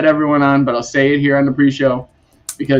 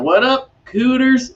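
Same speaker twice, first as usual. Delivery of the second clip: drawled Southern greeting, cooters; high, slow Southern drawl on "cooters"; imitating Wes's Southern "What up, cooters?"; Southern accent; 10 semitones higher than usual. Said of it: "that Southern accent".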